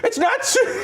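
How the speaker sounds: high mock outburst; imitated freak-out. Passenger correcting her name, imitated high freak-out. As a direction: start correcting in a high, outraged voice. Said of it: "she, like, freaks out".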